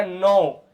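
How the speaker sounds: नो with a drawn-out O; long vowel. Shown as a demonstration. Teacher modelling a long vowel after a consonant, नो with long O. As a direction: stretch the O in नो. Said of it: "लंबी होगी।".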